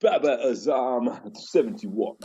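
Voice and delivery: put-on slow, drawn-out name in a South African accent. Host keeping the voice up for his co-host, South African accent. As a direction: stretch the name like a Jo'burg commentator. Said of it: "There we go".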